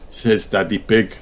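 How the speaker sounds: plain reading voice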